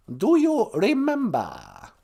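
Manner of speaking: high, slow, drawn-out question; slow falsetto learner; high-pitched guest character. Acted. Guest figure translates the phrase.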